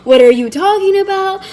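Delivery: loud, high imitation of feigned bafflement; mock indignant denial. Retold partner's gaslighting denial.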